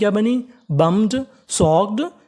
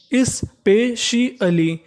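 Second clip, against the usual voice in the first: Specially very slowly and higher, as is-pe-shi-alee; syllable by syllable; 4 semitones higher, much slower than usual. Shown as a demonstration. Teacher spelling out sounds for learners, syllable by syllable. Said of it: "इसे पढ़ेंगे स्पे".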